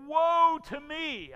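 high, loud and slow lament; drawn-out cry of woe; anguished cry. Acted. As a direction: cry out in dread before God. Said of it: "I cried".

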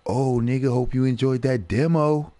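gleeful mock taunt; taunting game voice. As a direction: let the game rub it in with a sneer. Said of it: "and it's like".

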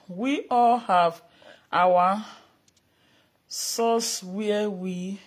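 slow, deliberate statement; slow solemn. Real, not acted. Vlogger declaring Benin's traditional roots, slow and deliberate. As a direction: weigh each word slowly.

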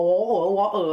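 imitating "water" with no t; mimicked London "water", t swallowed; Cockney "water". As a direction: say "water" as WAW-uh. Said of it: "ถ้าเป็นอย่าง Cockney".

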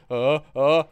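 loud mock grunts; hammed-up feeble grunts; mock statue groan. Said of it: "The statues are like".

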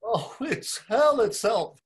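high, loud, slow wail of pain; crying out in pain. Acted. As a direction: moan as if the arm is breaking. Bloom's arm twisted, in pain.

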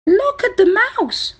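very high-pitched monkey cry; chattering monkey. A narrator's reading. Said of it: "chattered a monkey".

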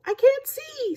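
very high little snail squeak; snail in the dark. A narrator's reading. Snail lost in dark seaweed, high squeaky voice. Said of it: "said the snail".

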